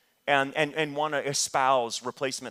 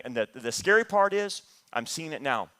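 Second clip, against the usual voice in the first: ordinary delivery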